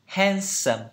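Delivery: handsome with an American "a" toward "e"; American pronunciation. Shown as a demonstration. Teacher echoing the American dictionary audio, American accent on handsome. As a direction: copy the American handsome, "hen-sum". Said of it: "Anh Mỹ thì sao?".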